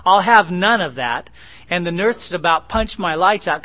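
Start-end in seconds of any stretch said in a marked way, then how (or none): 0.0-1.3 loud, joking order to the wife; mock stern yell. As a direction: bark it out as a joke, no real anger. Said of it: "I was just kidding my wife".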